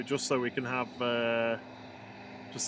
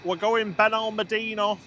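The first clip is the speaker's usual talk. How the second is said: put-on Manc accent, a bit higher and louder; Manchester accent; 6 semitones higher, 5 dB louder than usual. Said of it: "It's my best Manc accent for you there".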